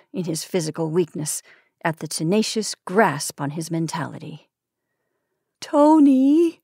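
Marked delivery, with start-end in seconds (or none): 5.6-6.6 higher, louder wailing call. Acted. Wife calling her dying husband, wailing. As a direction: wail his name. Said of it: "she wailed on the last day".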